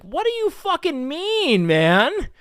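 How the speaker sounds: high, loud, slow outraged scolding; mock outraged. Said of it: "I was like".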